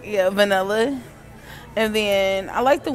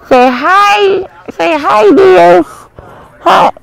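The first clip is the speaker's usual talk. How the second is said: loud puppy greeting; 7 semitones higher, 14 dB louder, slower than usual. Real, not acted. Owner greeting the puppy she is holding, higher and louder baby talk. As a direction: call hi up high to the puppy.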